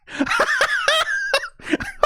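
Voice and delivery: a shrieking, helpless laugh up high; real delighted squeal of laughter. Real, not acted. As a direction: burst into a high-pitched cackle. Laughing at the cheesy scene.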